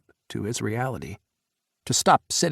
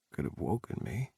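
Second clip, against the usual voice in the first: much lower and softer, drowsy; soft morning murmur; 6 semitones lower, 10 dB quieter than usual. A narrator's reading. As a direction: murmur it half asleep. Sleepy morning in bed.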